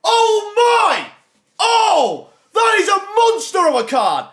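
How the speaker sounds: real high, loud cheer over a big pull; ecstatic shouting. Real, not acted. Streamer celebrating a monster pack pull to viewers, shouting high and loud. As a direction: roar with joy at the card. Packs a great card.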